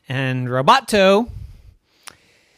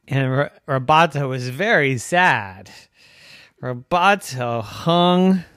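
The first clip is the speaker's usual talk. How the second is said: ordinary delivery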